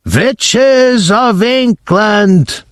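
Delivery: commanding screech that stretches every word; Grand High Witch shouting. Acted. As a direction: roll it out slowly like a tyrant addressing her army. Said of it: "shouted the Grand High Witch".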